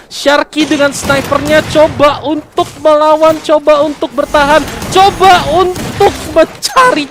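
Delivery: rising play-by-play hype, drawn out; fighting game announcer. Real, not acted. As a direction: call the last stand with mounting tension.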